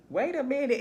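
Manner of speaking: mock double take. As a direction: stop and question what you just heard. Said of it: "And you be like".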